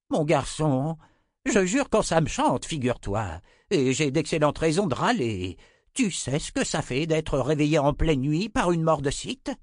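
put-on grumbling old wizard. A narrator's reading. Grandfather scolding Richard for waking him, cranky grumble.

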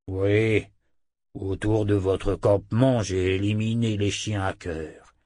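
loyal talking-wolf voice; loup parlant. Acted. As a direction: report as the guardian wolf. (voix de loup fidèle)

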